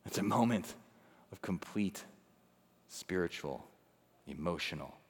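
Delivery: low, very soft, with long pauses; hushed solemnity. Real, not acted. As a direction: let each phrase sink into darkness. Somber pause on total darkness.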